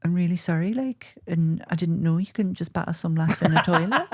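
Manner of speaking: high mock-apologetic pop star. Singer excusing an assault in an interview, high mock-contrite impression. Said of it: "she sat there and she's like".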